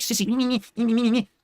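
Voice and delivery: mocking whiny child, me me me; bratty kid whine. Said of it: "like little whiny kids".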